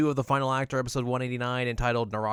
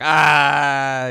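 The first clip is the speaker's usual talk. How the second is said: loud mock scream "Ah!"; startled yelp; 4 semitones higher, 12 dB louder than usual. Sango crying out at the illusion, loud. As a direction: yelp in hammed-up fright. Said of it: "and Sanga's like".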